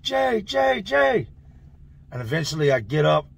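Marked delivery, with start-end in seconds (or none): 0.0-1.3 high, mock yell at normal volume; high urgent calling, held back; imitated name-calling. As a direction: call out to wake someone, pitched way up. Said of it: "I hear somebody yelling my name".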